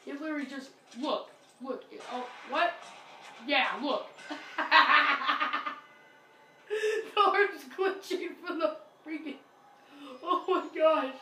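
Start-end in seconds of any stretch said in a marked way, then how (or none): 4.6-11.1 real laughter, talking through it; laughing. Real, not acted. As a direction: laugh out loud at the glitch. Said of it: "Sorry about that laugh".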